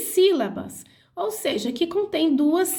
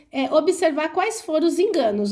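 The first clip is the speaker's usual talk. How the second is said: ordinary talk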